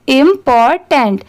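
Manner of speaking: important stretched very slowly, syllable by syllable. Shown as a demonstration. Teacher blending syllables for beginners, very slow. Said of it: "अब इन तीनों को मिला देंगे".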